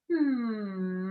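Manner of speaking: steady closed-lip hum, gliding down; long hum. Shown as a demonstration. Coach circling the hips, long hum. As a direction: hum through one long breath. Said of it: "put a hum in that".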